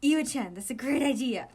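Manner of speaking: loud, bright-eyed delight; light up with excitement. Acted. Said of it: "Oikawa's face lit up".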